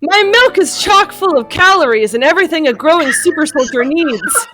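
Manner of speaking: hammed-up big reveal; dramatic confession. Tony's confession, read comically.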